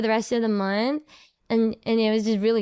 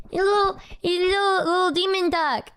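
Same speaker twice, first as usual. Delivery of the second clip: high, quick cooing; 6 semitones higher than usual. Real, not acted. Showing off the demon duck, cutesy cooing. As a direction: coo over a tiny cute creature. Cooing over cute tattoo.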